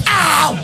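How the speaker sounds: high shouted "ow"; acted yelp of pain. Dad has just stubbed his toe, acted pain yelp.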